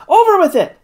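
high, loud emergency command; urgent high shout to the crew; shouted order. A narrator's reading. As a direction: shout it high, as the balloon drops. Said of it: "he shouted".

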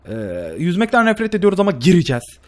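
usual speaking voice

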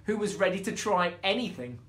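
plain reading voice

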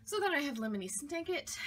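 usual speaking voice

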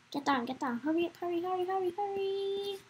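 anxious rising chant; urgent hurrying. Real, not acted. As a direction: urge yourself on, pitch rising to a held last word. Rushing downstairs for swimming class.

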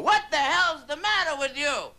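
put-on high, wobbly little-old-lady voice, the last words stretched slow; shrill old neighbour banging on the door; cranky old lady. Old lady next door yelling through the door, high and creaky. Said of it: "a little old lady that lives in the next apartment".

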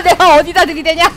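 laughing through the words, loud. Real, not acted. Streamer mocking own failed charge, laughing loudly. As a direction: laugh at your own blunder as you speak.

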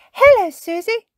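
high, loud greeting cried out; excited cry. A narrator's reading. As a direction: cry out an eager hello in a high child character voice. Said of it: "cried Pippa when she arrived".

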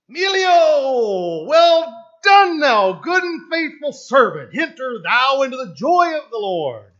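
triumphant cry of well done; jubilant shout. Pretend. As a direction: proclaim it like heaven's welcome. Preacher voices Christ welcoming him.

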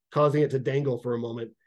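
usual speaking voice

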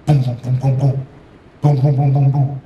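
loud, punchy booms; rapid-fire knocking imitation, punched hard; imitated door banging. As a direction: pound out the knocks with the voice. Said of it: "beating on the door".

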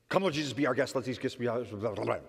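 put-on kids racing through the table prayer, running into babble; speed-mumbled mock prayer; really fast rattled prayer. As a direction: rattle it off as fast as you can, words dissolving into gibberish. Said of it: "really fast".

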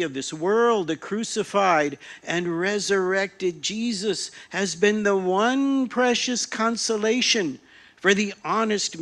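loud, impassioned apostle preaching; fiery preacher Paul. Acted. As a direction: thunder it like a zealot. Paul's fiery preaching speech.

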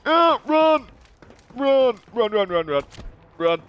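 panicked yelling to run; frantic high shout while fleeing; being chased. Real, not acted. Ambushed, fleeing enemies.

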